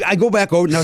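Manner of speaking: normal talking voice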